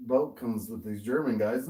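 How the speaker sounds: ordinary delivery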